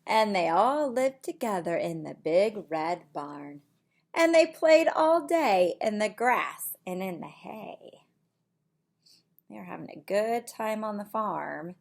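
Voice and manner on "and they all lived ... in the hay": lively storybook voice for little ones; reading to children. Acted. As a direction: read it like bedtime story time. Reads picture book aloud.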